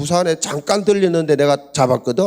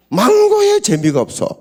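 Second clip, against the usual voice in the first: much higher, louder complaint; exasperated cry; 3 dB louder than usual. Real, not acted. Husband complaining the wife has no fun, high exasperated cry. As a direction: throw up your hands.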